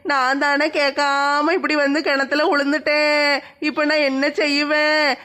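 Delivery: hammed-up self-pitying crying, almost like singing; wailing lament. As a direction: lament aloud, stretching out each last vowel. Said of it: "படுத்து கிடந்து தனியா புலம்பிக்கிட்டு இருக்க?".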